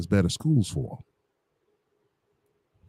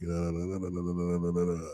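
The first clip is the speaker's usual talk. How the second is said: soft, low run of la-la-las; skimming under breath; 4 semitones lower, 11 dB quieter than usual. Real, not acted. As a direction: skim through text with quiet filler syllables. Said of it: "let me skim through it right quick".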